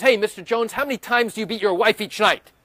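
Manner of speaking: loud, accusing lawyer question; mock lawyer accusation. Defense lawyer grilling the witness, loud and accusing.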